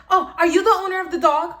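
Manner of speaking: loud, high and fast demanding question; demanding lady. Retold or imitated. Angry neighbor lady confronting Jose, loud high demanding. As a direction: mimic a pushy, entitled woman. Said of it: "Look how she's talking".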